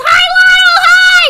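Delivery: high, loud happy greeting; excited shout. Real, not acted. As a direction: call out with delight. Greeting a friend in chat.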